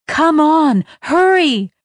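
high, loud hurrying shout; urgent call. A narrator's reading. The voice hurrying the girl inside, loud urgent call. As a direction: hurry her along.

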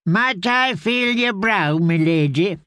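a hint of a gentle old caretaker; kindly old man. Grey man tends her.

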